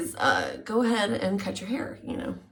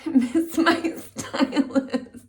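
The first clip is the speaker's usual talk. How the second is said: laughing; 7 semitones higher, 7 dB louder, slower than usual. Real, not acted. Laughing lament for stylist.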